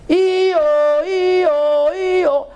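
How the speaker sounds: high wailing mock siren; siren imitation. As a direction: wail it like an alarm siren.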